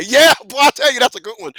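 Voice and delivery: ordinary talk, nothing marked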